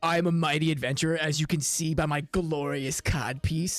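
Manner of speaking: low, slow, self-important fighter; boastful adventurer. Acted. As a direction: proclaim your might like a pompous champion. Game master voices boastful adventurer.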